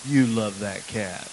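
slow, low retold insistence; retold child's insistence. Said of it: "She goes".

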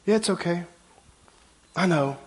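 mock gentle reassurance, hushed; soft placating voice. Preacher mocking soft reassurance to a sinner, hushed. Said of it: "and say".

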